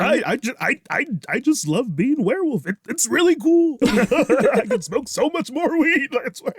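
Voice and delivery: high, laughing stoner voice; giggly goofy werewolf talk; Seth Rogen werewolf. Pretend. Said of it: "I love your Seth Rogen".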